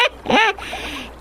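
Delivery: a high, soft creature chuckle; sly high chuckle before the next line; sly laugh. Acted. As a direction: laugh quietly over the trick.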